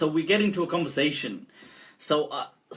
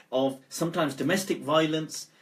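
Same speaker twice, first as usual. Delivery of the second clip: ordinary talk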